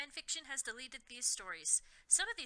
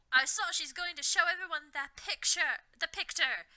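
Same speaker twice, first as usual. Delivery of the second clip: higher, louder angry protest; angry shout; 5 semitones higher, 5 dB louder than usual. A narrator's reading. Harry protesting about the picture, angry shout. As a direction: snap back in outrage. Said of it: "Harry shouted angrily".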